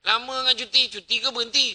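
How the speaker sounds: wife needling her husband about endless leave, high and sharp; sarcastic wife. Retold or imitated. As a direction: poke fun at his long leave. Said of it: "bini tanya".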